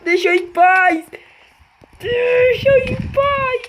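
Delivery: high loud frantic begging; panicked pleading. Real, not acted. Begging monster to leave.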